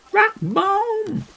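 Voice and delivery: very high, squeaky little cry. Pretend. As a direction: squeak it like a tiny cartoon critter. Squeaky call of enemy name.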